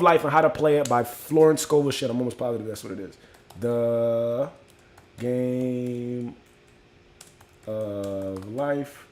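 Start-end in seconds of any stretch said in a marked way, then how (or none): 3.6-9.1 each word stretched long, with pauses; drawn-out words. Real, not acted. Host slowly putting up the book title, drawn out.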